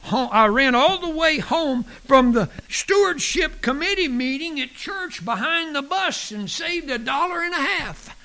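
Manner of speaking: high-pitched, hammed-up breathless boast; mock exhausted husband. Husband boasts to wife of running home, mock exhausted. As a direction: push it out high, as if just back from a run. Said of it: "almost completely exhausted".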